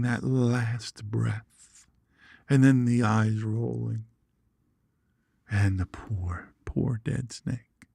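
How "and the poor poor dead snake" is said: slow, whispered mock grief; mourning the snake that is faking its death; mock sad whisper. Real, not acted. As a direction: hush it like mourning a dead pet. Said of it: "I felt so sad for it".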